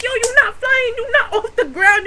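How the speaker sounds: high-pitched incredulous objection breaking into laughter; retold exasperated protest. Teller to friend who thinks he can fly, high exasperated mock protest. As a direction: argue with a deluded friend, amused.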